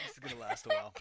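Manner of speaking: high laughing through a burp; laughing while burping. Real, not acted. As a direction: giggle and burp the words out. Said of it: "Sorry, I keep burping".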